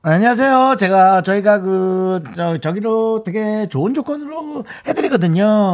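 high, eager sales pitch voice; telemarketer voice. Pretend. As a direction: sound like a pushy insurance caller. Playing insurance salesman.

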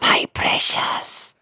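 hissing whispered Gollum impression; creepy creature whisper, slow on precious; Gollum quote. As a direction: whisper it like Gollum stroking his ring. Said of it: "이런 명대사가 있죠".

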